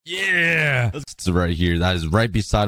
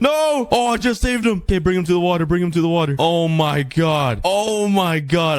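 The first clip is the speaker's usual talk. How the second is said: relieved; 7 semitones higher than usual. Real, not acted. Relief after saving the dog.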